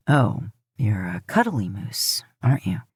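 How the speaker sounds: fond teasing. Acted. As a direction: coo at the big cuddly moose. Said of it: "Dean chuckles lightly".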